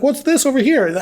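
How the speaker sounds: high, playful imitation of a curious man; mock oblivious curiosity. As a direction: pitch it up like a hapless cartoon character. Voicing the man before he falls.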